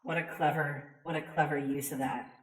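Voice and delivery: ordinary delivery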